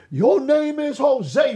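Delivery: high, loud, drawn-out revelation; proclaiming. Retold or imitated. Preacher reveals a stranger's name, proclaiming. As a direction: call the name out slowly like a prophecy.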